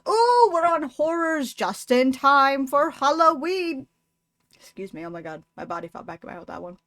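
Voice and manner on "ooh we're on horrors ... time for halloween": louder, higher, slowed with glee; excited Halloween cheer. Real, not acted. To her viewers, thrilled the horrors land on Halloween, excited. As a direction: savour the spooky-season thrill.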